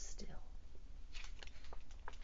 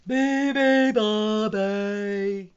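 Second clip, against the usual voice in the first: loud, high hippo chant; hippos' blaring call; yelled; 5 semitones higher, 15 dB louder, much slower than usual. A narrator's reading. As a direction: yell each syllable long. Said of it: "yelled the hippos".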